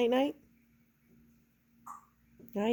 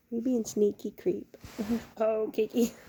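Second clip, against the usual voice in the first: normal talking voice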